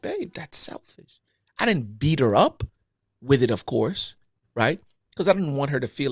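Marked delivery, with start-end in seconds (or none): 0.0-1.2 gentle rebuke. Retold or imitated. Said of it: "let her know too".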